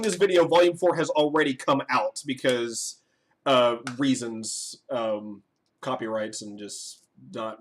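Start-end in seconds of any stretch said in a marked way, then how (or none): none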